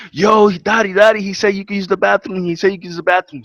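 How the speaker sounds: put-on high, eager little-kid voice calling Daddy; excited kid brother. Brother calling to him from the store, high and excited.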